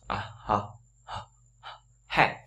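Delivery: "ha" breathed out like a dog panting. Shown as a demonstration. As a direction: pant the h in "ha". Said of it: "đọc cũng như chó thở".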